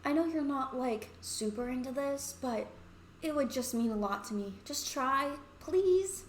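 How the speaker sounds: put-on coaxing girl, rising to a high please; pleading Misha. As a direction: gently beg a reluctant friend. Misha pleads with hesitant friend.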